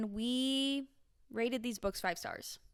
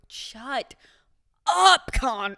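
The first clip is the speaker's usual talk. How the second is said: mock fed-up shout, higher on "up"; retold exasperated yell; 4 semitones higher, 10 dB louder, much slower than usual. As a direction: split it after "Shut", then burst out louder. Retold yelling at the screen.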